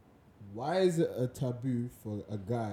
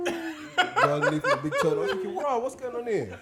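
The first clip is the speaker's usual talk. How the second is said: high, laughing through the words; can barely get it out for laughing; 13 semitones higher, 5 dB louder than usual. Real, not acted. Telling a funny story, laughing.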